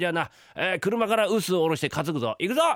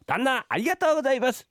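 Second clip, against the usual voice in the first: workers' called-out thanks; 10 semitones higher than usual. Pretend. Husband as apprentices thanking the master, calling out high. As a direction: call your thanks up to the master. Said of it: "礼を申し上げろよ".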